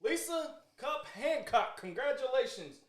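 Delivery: slow, high, drawn-out announcement; winner announcement. Real, not acted. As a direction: announce the winner like a game show host.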